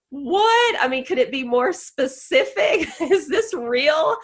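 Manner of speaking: sudden high, loud "What?" dissolving into laughter; stunned laughing disbelief. Retold or imitated. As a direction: relive the shock of the book that fit exactly, laughing. Retelling finding the perfect book.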